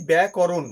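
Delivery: ব্যাকরণ with a short 'ko' in the middle; correct pronunciation. Shown as a demonstration. Teacher showing how she pronounced it, correct pronunciation. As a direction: say ব্যাকরণ as byæ-ko-ron, no long 'a' after k. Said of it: "এটার উচ্চারণ আমি করলাম কি".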